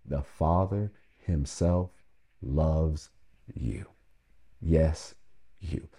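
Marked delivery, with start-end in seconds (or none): 0.1-3.8 slow, with a pause before each word; slow declaration. Shown as a demonstration. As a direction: lay each word down slowly, loves then you. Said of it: "what I'm declaring to you today".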